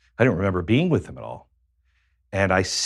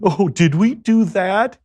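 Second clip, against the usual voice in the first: high, slow, loud mock-innocent oops; movie-style cute drunk; 10 semitones higher, 6 dB louder, slower than usual. Mocking how movies make blackouts cute, high coy surprise. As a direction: play it charming and ditzy, drawn out and high. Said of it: "they make that funny and charming".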